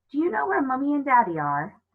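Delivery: put-on high, worried child voice; a small girl asking hopefully; anxious girl. Coraline begging the cat, high and anxious.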